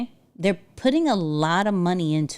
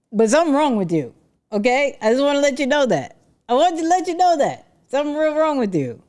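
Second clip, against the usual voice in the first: playful roast; 6 semitones higher, faster than usual. Real, not acted. Teasing the commenter.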